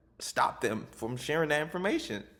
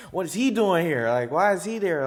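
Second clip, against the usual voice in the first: louder, pointed challenge; indignant questioning; 3 semitones higher, 6 dB louder than usual. Retold or imitated. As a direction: challenge his presence loudly, like a disapproving onlooker. Voicing hostile onlookers after getting passionate.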